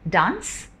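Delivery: ordinary talk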